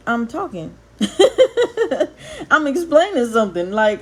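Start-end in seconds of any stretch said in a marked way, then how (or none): 1.0-2.5 high-pitched burst of laughter; real high laugh. Real, not acted. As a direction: laugh it off mid-rant. Laughs at being told quiet.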